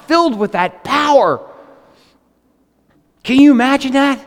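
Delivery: normal talking voice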